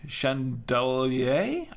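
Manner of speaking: chandelier drawn out slowly as "shan-del-AY" with a rising end; unsure pronunciation attempt. Shown as a demonstration. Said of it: "I don't know how to pronounce this".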